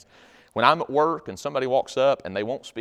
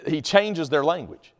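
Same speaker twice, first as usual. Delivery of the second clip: ordinary talk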